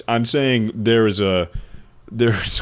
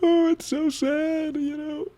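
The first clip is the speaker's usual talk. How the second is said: much higher, slow falsetto of fake grief; mock sad voice; 4 dB quieter, slower than usual. Child pretending to grieve at the funeral, high mock-sad falsetto. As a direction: ham up a mournful falsetto, faking sorrow. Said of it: "And I was trying to be like".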